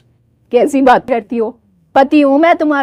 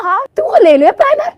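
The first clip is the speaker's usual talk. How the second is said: normal talking voice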